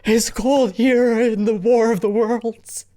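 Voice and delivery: high-pitched, hammed-up shivering voice; pretend freezing. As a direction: stammer it out as if freezing. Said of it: "Oh, Jet's freezing".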